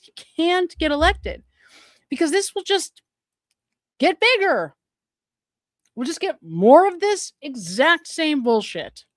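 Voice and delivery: exasperated warning that keeps climbing; heated insistence. Real, not acted. Commentator warning viewers about the candidate, heated and exasperated.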